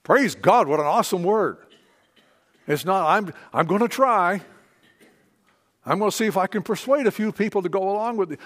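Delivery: loud, driving sermon delivery; preaching at full force. Acted. Pastor urging the congregation, loud full-force preaching. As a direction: preach it with conviction to the room.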